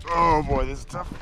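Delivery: straining and grunting uphill; effort grunt. Real, not acted. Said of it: "sorry for all the grunting noises".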